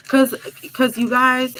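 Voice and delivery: ordinary talk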